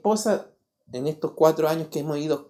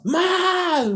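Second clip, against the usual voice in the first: retold yell; 12 semitones higher than usual. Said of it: "me gritó este alumno".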